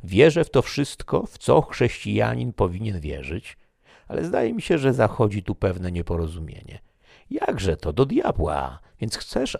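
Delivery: bantering gentleman teasing his friend; Toledo, lively. A narrator's reading. Toledo voiced in narration. (lekko zagrany wesoły Toledo, wyżej i głośniej)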